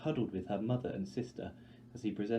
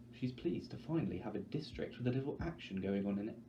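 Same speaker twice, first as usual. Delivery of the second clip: ordinary delivery, nothing marked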